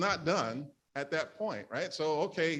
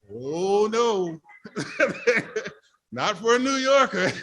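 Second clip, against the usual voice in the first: loud, laughing no, not for a New Yorker; half-laughing outcry, louder and higher; laughing protest; 6 semitones higher, 7 dB louder than usual. Real, not acted. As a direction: laugh it off in mock horror. Laughs at moving to Jersey.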